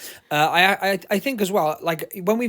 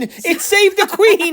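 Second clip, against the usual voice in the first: laughing shout; 12 semitones higher, 8 dB louder, much slower than usual. Real, not acted.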